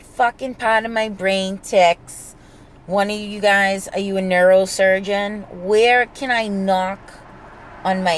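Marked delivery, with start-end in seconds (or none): none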